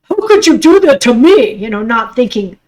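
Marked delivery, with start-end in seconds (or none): 0.0-1.5 imitating hurt outrage. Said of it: "just like everybody thinks".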